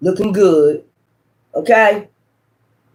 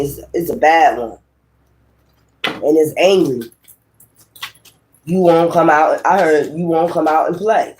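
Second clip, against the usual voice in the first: ordinary talk, nothing marked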